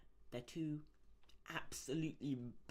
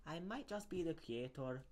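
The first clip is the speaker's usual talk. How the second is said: lightly Scottish, higher; Scottish accent; 5 semitones higher, 4 dB quieter than usual. Acted.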